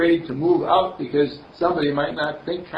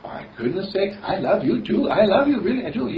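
higher, laughing, moved mother; touched mother; 7 semitones higher than usual. Acted. Mother answers her daughter, touched and laughing. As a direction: answer with delighted surprise. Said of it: "her mommy looked at her ... and said".

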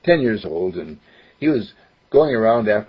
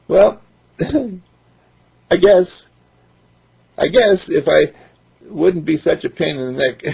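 put-on higher boy's voice, eager; young boy voice; 7 semitones higher than usual. Boy explains he only got in dad's way, higher boyish voice. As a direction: voice a ten-year-old who wants to help. Mark sheepish.

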